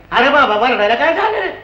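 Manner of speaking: a working woman cutting in from the back of the queue; poor labouring woman. Retold or imitated. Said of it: "मागून एकदम एक आवाज आला".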